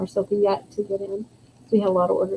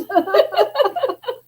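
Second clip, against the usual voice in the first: high laugh. Real, not acted.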